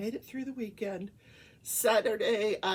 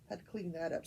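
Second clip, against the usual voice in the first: ordinary delivery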